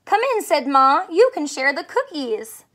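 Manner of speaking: lively picture book reading; story read to children. Acted. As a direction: read it like storytime for little ones. Reads picture book aloud.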